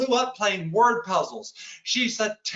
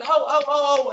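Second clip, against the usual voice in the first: mock outrage; 6 semitones higher, 5 dB louder than usual. Outraged reaction to the dare.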